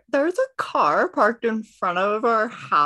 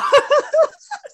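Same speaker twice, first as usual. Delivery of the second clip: burst of high laughter; 6 dB louder than usual. Real, not acted. Player cracks up mid-call.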